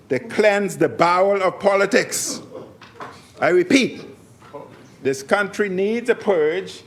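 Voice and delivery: forceful oratory. Real, not acted. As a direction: thunder it at the whole chamber. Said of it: "listen to me".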